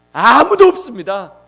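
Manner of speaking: loud, slow, drawn-out 아무도 없습니다; emphatic declaration. Acted. Preacher answering his own question, loud and slow. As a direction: hammer 아무도 home and stretch the ending.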